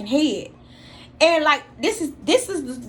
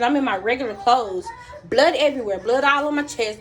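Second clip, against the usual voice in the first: ordinary talk, nothing marked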